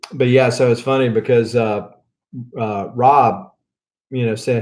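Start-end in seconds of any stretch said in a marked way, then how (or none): none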